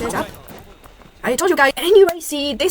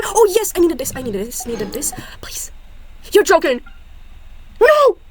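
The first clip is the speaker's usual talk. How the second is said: ordinary delivery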